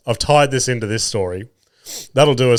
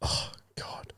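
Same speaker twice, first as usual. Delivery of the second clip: whisper; 20 dB quieter than usual. Real, not acted. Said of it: "unvoiced whisper".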